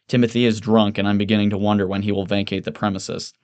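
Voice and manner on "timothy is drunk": ordinary delivery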